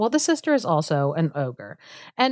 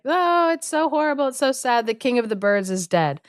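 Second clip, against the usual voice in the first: high-pitched fake wail, gradually sinking; hammed-up lament without real tears or shouting; mock crying; 6 semitones higher than usual. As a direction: wail it like a badly faked mourner. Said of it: "crying and screaming about".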